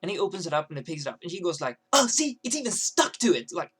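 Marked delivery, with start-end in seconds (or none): 1.9-3.5 high, loud mocking gripe; bitchy complaint. Girl griping at the guy about stuck toast, bitchy. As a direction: imitate her finding fault. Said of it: "keeps doing what she's doing".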